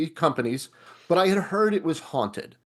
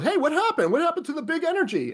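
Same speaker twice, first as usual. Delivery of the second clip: higher, laughing mock director; half-laughing, high-voiced director asking what happened; puzzled director; 10 semitones higher, faster than usual. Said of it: "And the director goes".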